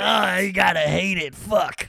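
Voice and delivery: loud, drawn-out comic lament; playful shout. Real, not acted. Joking with friends about being pranked, loud mock lament. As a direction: holler it in mock agony.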